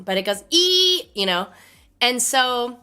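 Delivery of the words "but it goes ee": high, loud "ee" like a stretched balloon; imitated squeak. Shown as a demonstration. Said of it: "I can't really do it because my voice is gone".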